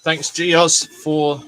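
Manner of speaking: loud cheerful thanks; loud shout-out. Real, not acted. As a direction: call the name out loud. Louder repeated thanks.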